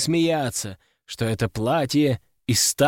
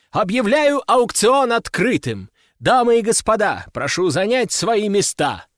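auctioneer's shout; 6 semitones higher, 3 dB louder than usual. A narrator's reading. Auctioneer opens the auction for ladies and gentlemen, calling out. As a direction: call the hall to order. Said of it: "прокричал распорядитель".

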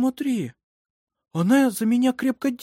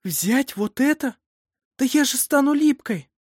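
high, indignant protest; squeamish objection; 13 semitones higher, 4 dB louder than usual. A narrator's reading. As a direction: balk at an unpleasant order. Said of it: "Карин возразила".

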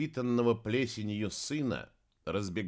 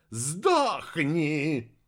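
drawn-out, menacing cry in raised pitch; growling; 5 semitones higher, 4 dB louder than usual. A narrator's reading. Heir strangling his mother, growling. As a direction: draw out the murderous command. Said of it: "прорычал носатый".

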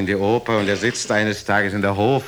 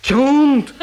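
high, loud and itching to hear more; breathless curiosity; 7 dB louder than usual. Acted. Listener begging for the juicy end, squealing high and loud. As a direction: squeal for the next detail.

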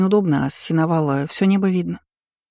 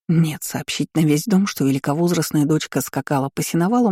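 plain reading voice

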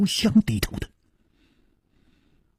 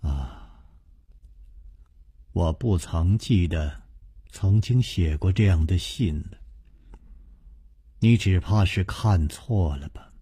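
low, softer, offhand denial; indifferent; 8 semitones lower, 6 dB quieter than usual. A narrator's reading. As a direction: brush it off coolly. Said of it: "淡淡地说". (淡淡地，漫不经心)

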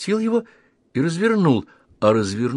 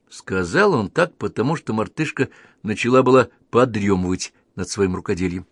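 ordinary delivery, nothing marked

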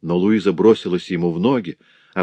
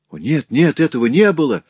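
high, loud desperate denial; forceful cry; 7 semitones higher, 4 dB louder than usual. A narrator's reading. Louise denying the charge to her father, forceful high cry. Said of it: "силой воскликнула Луиза".

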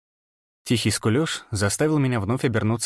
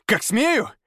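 near growl; 11 semitones higher than usual. A narrator's reading. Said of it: "Я чуть не зарычал".

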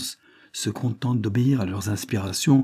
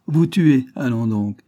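raised, loud taunting offer; mocking; 5 semitones higher, 4 dB louder than usual. A narrator's reading. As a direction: goad him with scornful confidence. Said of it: "d'un ton moqueur". (raillerie provocante)

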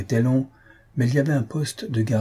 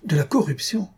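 high-pitched mock outrage, not shouted; feigned indignation; 7 semitones higher than usual. A narrator's reading. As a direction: pretend to be scandalised, pitching it high. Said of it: "s'écria Bengali en feignant l'indignation".

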